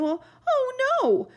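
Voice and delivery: high, loud dismayed cry; horrified cry. A narrator's reading. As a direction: cry out in horror. Captain about to eat oobleck.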